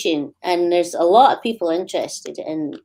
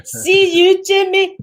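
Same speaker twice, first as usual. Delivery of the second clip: higher hammed-up Glaswegian "See you, Jimmy"; mock Scottish; 11 semitones higher, 4 dB louder, slower than usual. Joking about Scots to the hosts in a hammed-up Glasgow accent. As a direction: play the Glasgow hardman, laughing at it. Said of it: "Scottish people are angry".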